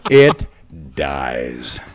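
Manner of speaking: loud IT, then DIES dropped low and drawn out; dramatic punchline drop. Retold or imitated. Comic climax as the bar goes silent.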